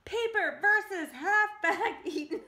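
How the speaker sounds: loud, high announcing through a laugh; giggling ring call; laughing announcer. Real, not acted. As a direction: call the matchup while cracking up.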